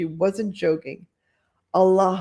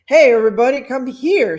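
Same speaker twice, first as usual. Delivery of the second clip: excited call again; 5 semitones higher, 9 dB louder than usual. Acted. Host calling the party guests over, loud, less pitched. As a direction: shout it over, less high. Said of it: "said the man excitedly".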